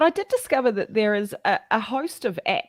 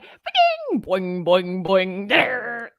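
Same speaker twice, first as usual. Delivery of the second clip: fax machine impression; 3 dB louder, much slower than usual. Shown as a demonstration. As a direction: imitate a fax handshake. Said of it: "'Cause it's like".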